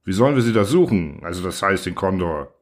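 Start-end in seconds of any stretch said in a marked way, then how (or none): none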